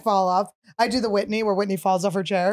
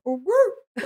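high mock dog whine, pitched up; 7 semitones higher than usual. Shown as a demonstration. Host tries a dog voice for the quote, a high yelp. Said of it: "a dog voice".

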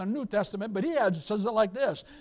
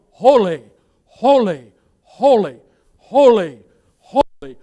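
each "holy" shouted much louder, falling; cherubim's cry; 13 dB louder, slower than usual. Acted. Preacher voicing the cherubim praising God, loud cries. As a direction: ring out every holy like angels at the throne. Cherubim crying before the throne.

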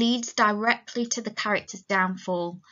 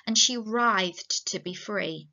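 ordinary talk

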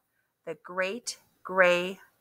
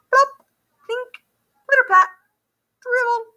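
much higher, plinking raindrop words; storyteller voicing raindrops, high plinks; rain sounds; 4 dB louder than usual. A narrator's reading. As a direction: make each drop plink up high.

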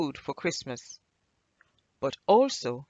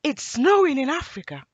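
high, loud, thrilled exclamation; delighted high holler; excited scream; 9 semitones higher, 11 dB louder than usual. A narrator's reading. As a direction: shout the amazing news like an excited child. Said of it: "he screamed, excited".

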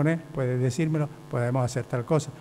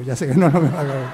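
laughing; 12 dB louder, faster than usual. Retold or imitated. Teacher answers boy's retort.

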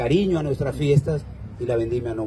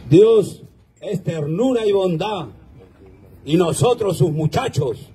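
toast recitation; 4 semitones higher, 3 dB louder than usual. Acted.

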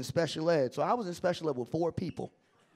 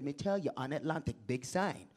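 low, softer Jamaican patois; Jamaican accent; 7 semitones lower, 7 dB quieter than usual. Retold or imitated. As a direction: give directions as a Jamaican promoter. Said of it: "messing with some Jamaicans".

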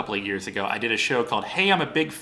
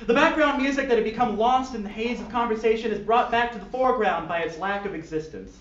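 lively storyteller read; story narration; 10 semitones higher than usual. Acted. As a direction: narrate it like a silly audiobook. Reads fan fiction aloud.

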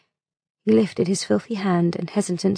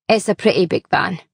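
defensive mutter. A narrator's reading. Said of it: "muttered defensively".

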